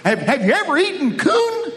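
high, laughing put-on voice of the asker; quoting a curious asker. Somebody asking the preacher about eating coon, laughing high voice. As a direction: squeak the question out through a laugh. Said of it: "Somebody asked me the other day, he said".

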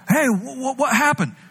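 high surprised waking voice; confused just-woken Jesus. Acted. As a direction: sound groggy and baffled.

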